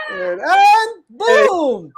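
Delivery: high, loud, slow boom; drawn-out boom. Real, not acted. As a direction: stretch the boom out big. Repeating the reveal.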